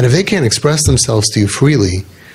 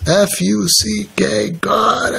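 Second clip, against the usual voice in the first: high, drawn-out mock curse; retold cursing; 6 semitones higher, 3 dB quieter, much slower than usual. Teacher retells a hurting kid cursing God, mock curse. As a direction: spell it out slowly, pitched up, imitating a cursing kid. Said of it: "he was cursing God".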